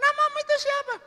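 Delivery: squeaky-high falsetto questioning; woman's voice. Acted. Woman asking the visitor's name, high falsetto.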